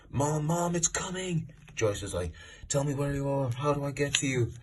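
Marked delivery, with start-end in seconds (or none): 0.1-1.5 high, drawn-out cry at moderate volume; mock panicked call to mother; imitated alarmed shout. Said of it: "he shouts". 2.7-4.5 high, sustained, pleading questions; imitated urgent mother. Acting Joyce's urgent answer to Will.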